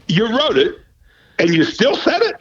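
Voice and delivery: high, disbelieving questions; incredulous boss. Retold or imitated. General manager to the sportscaster, stunned disbelief. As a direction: boss can't believe it was scripted. Boss voiced in retold story.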